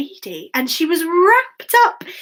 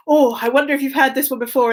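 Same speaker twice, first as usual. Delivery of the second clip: ordinary delivery, nothing marked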